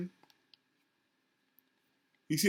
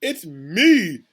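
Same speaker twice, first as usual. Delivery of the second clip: higher, exasperated protest building to a loud ME; indignant yelling; 4 semitones higher, 6 dB louder, slower than usual. A narrator's reading. Mister Payne scolding the captain for saving animals, indignant yelling. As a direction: protest loudly, hammering the last word. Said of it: "yelled Mister Payne".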